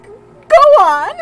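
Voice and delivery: loud, high-pitched bad German accent. Oma egging grandfather on, bad German accent. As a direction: goad him in a hammed-up German accent. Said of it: "very bad German impersonation".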